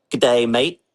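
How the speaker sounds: a quick, hammy Aussie greeting in an Australian accent; mock Australian accent. Shown as a demonstration. Weatherman greets late viewers in a jokey Australian accent. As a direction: toss off a cheery Australian "Good day, mate". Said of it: "That's the only Australian thing I can do".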